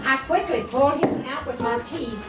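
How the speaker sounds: normal talking voice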